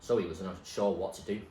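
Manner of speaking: ordinary delivery, nothing marked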